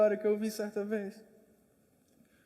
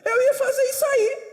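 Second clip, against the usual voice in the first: high, laughing outrage; laughing indignation; 13 semitones higher, 9 dB louder than usual. Retold or imitated. The first student protests to the teacher, high and laughing.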